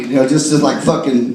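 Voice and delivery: ordinary talk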